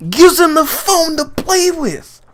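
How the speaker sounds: high, loud, drawn-out disbelief; exasperated near-shout; incredulous outrage. Real, not acted. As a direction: exclaim it slowly, appalled. Outraged retelling of dad's fix.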